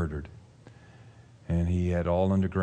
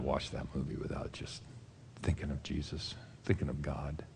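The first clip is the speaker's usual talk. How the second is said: hushed whisper; 4 semitones lower, 11 dB quieter than usual. Real, not acted. Hushed reflection after the story.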